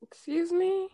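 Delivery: high, soft "Excuse me?"; incredulous. Acted. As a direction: act offended disbelief. Mock reaction to intruders.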